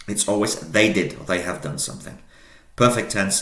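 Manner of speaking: ordinary talk, nothing marked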